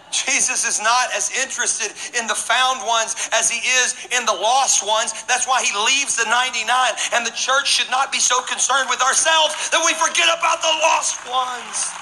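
preaching that climbs in pitch to a shout; high, forceful pulpit delivery; impassioned preaching. Real, not acted. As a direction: drive the point home to the congregation.

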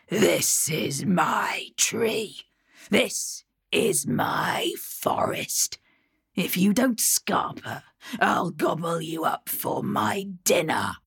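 slow, menacing troll whisper; a troll hissing a warning from the branches. Acted. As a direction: breathe the threat out slowly like a lurking troll. Troll threatens from the trees.

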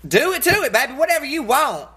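high-pitched, playful go-ahead; retold eager consent. Woman retelling her reply to her boyfriend, high and eager.

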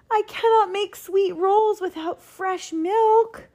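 high, slow wail of dismay; despairing cry. A narrator's reading. Baker with no milk for rolls, despairing cry. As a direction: lament it slowly in a high-pitched voice. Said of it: "cried Walter".

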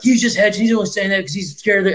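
a hint of a scoffing critic; mocking critics. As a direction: sneer it like a know-it-all fan. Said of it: "everyone was like".

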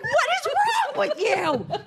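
high, drawn-out mock wail; upset outburst. Upset mother to her three-year-old, high exasperated wail. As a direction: stretch it out in exasperated disbelief. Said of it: "I was just so upset".

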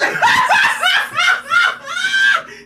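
very high, loud cackle; uncontrolled laughter. Real, not acted. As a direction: burst out laughing hard. Cracks up at own retold line.

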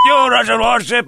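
high shouted taunt. Retold or imitated. The wiseacre shouting at the warship, taunting yell. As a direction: yell it out at the ship.

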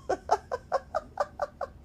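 high airy giggle; a real burst of breathy laughter; breathless laugh. Real, not acted. Laughing at own yawning.

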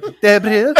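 "De Abril" with a rolled, trilled R, said high; rolled Portuguese R. Retold or imitated. Mimicking the vacationing witness, trilled "De Abril". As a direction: roll the R in "Abril" like a native. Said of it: "she rolls the R's with it".

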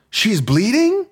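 put-on high, alarmed little girl voice; imitating a frightened young daughter; alarmed child. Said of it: "My daughter, alarmed".

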